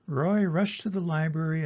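usual speaking voice

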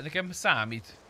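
normal talking voice

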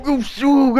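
usual speaking voice

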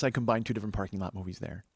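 usual speaking voice

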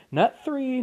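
ordinary delivery, nothing marked

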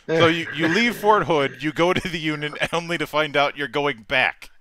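high, loud incredulous recap; amused disbelief. Real, not acted. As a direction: raise voice and pitch in laughing disbelief. Incredulous recap of friend's bad luck.

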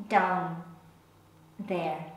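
hushed, reluctant euphemism; discreet euphemism. Acted. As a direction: drop low and quiet on the delicate words. Euphemism for her private parts.

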